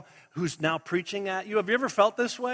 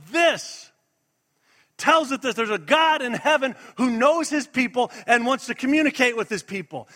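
raised, driving sermon voice; emphatic preaching; 3 semitones higher, 4 dB louder than usual. Real, not acted. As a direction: preach it with mounting conviction. Preacher builds to a forceful declaration.

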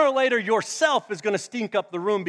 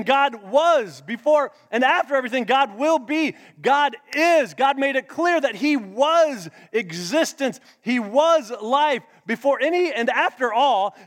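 louder, rising sermon cadence; full-force preaching; 6 semitones higher, 8 dB louder than usual. Acted. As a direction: build it like a preacher at the peak.

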